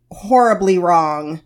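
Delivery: ordinary delivery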